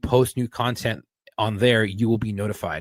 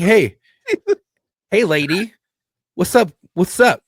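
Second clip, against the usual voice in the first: higher, louder, laughing mock pickup line; hammed-up flirty catcall; 9 semitones higher, 7 dB louder, slower than usual. Shown as a demonstration. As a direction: overdo the cheesy hey lady with a laugh. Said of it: "don't say it like that".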